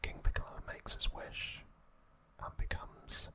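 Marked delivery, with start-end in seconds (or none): none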